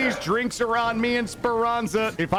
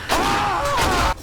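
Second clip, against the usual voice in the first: long, high shriek; panicked scream; 11 semitones higher, 4 dB louder than usual. Real, not acted. Gamer cornered by enemies, panicked scream. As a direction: scream at full pitch in alarm.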